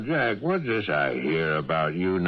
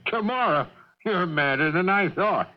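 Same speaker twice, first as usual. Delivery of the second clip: much higher, laughing in disbelief; incredulous laugh; 9 semitones higher than usual. Acted. Boss scoffs at a public demonstration tomorrow, laughing disbelief. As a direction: laugh the word out as if it's absurd.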